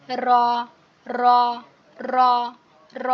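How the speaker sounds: র with a rolled, vibrating tongue; trilled r. Shown as a demonstration. Teacher demonstrating a trilled র to students. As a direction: roll the র like children's play sounds. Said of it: "একটা কম্পন সৃষ্টি হবে".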